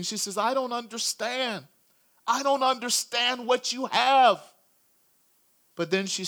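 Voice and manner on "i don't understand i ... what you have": slow, higher, tearful pleading; weeping disbelief. Acted. Wife weeping to her praying husband, slow tearful plea. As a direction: let it crack slowly through tears, pitched up. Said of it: "And she's weeping".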